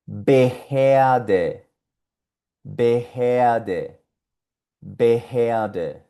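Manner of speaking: Behörde as bexeade, R vocalized; German Behörde. Shown as a demonstration. Teacher modeling German Behörde. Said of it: "palabritas que tienen esa combinación".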